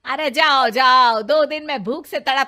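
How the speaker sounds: scornful shouting. Acted. Mother-in-law scoffs loudly.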